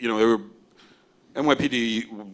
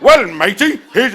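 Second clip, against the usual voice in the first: hammed-up pirate accent, much higher and louder; mock pirate; 12 semitones higher, 14 dB louder than usual. Agent mocking a demo reel's pirate accent, hammed-up pirate voice. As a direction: play a bad pirate accent from a demo reel. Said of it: "that was a pirate".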